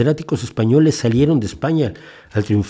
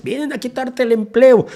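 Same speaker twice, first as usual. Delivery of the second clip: pitched way up, hammy mocking sneer; mock anti-immigrant cliché; 12 semitones higher than usual. Mocking what people always say about immigrants, high mock voice. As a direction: parrot the bigots' line with scorn. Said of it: "lo que siempre se dice de los inmigrantes".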